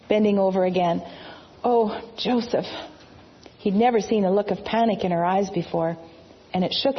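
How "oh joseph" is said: pained. Acted. Mary in labor pains.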